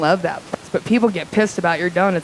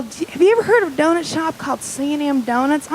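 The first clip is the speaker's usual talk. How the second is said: higher, mock Southern caller; Louisiana lady caller; 6 semitones higher than usual. Lady asking a Reno donut shop a question, high Southern voice. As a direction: put on a Southern lady's accent. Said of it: "She's all".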